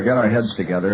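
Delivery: ordinary delivery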